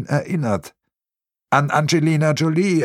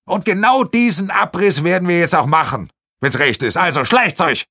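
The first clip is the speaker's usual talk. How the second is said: pushy project man; 6 semitones higher than usual. Acted. Project man sends them off.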